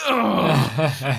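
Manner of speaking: loud exasperated "ugh"; re-enacted groan of frustration; frustrated groan. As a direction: let out a loud, fed-up groan. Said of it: "Just frustrating".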